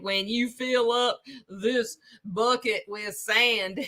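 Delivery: slow, exaggerated prospector accent; prospector drawl. Streamer mocking Mark's bucket story, slow prospector drawl. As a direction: stretch every word like an old-time prospector. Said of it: "This is how a prospector would talk".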